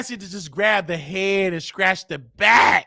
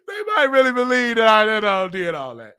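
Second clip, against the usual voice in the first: laughing. Real, not acted. Cracking up at own joke.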